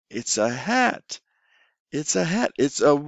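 put-on slow, drawn-out prompt for a child; teacher modelling a word. Shown as a demonstration. Teacher prompting a young student, slow and drawn out. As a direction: coax the word out slowly. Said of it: "you talk about color".

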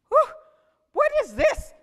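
a villager amazed at a strange creature; high astonished gasp of a question; surprised. Acted.

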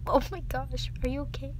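ordinary delivery

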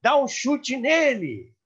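a joker's high, drawn-out heckle; retold prank shout. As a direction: bellow it like a prankster in the crowd. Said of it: "ele gritou assim".

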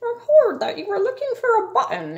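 high, quick critter voice; squeaky-high storybook animal; raccoon. A narrator's reading. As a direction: pipe up fast like a little raccoon. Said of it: "A raccoon came from behind a tree".

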